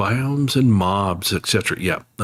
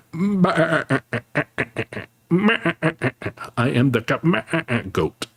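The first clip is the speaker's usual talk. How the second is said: bleating goat voice, jumping up high; goat impression; 7 semitones higher, much slower than usual. Streamer doing a goat bleat for chat. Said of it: "You like my goat impression".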